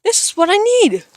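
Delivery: high, loud thrilled discovery; excited. Real, not acted. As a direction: burst out with joy at the find. Finds the lost item.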